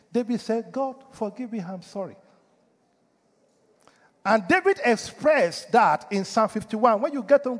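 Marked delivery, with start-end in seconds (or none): 0.7-2.2 softly acted apology to God; hushed, understated repentance; quiet confession. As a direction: voice a penitent's plea, dropping much softer. Voices David's confession to God.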